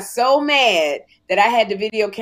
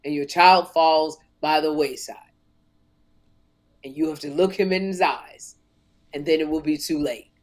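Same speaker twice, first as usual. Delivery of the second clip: lower, grave talk-show mentor voice; Oprah Winfrey impression; 6 semitones lower than usual. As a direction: warn her like a wise host. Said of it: "She said".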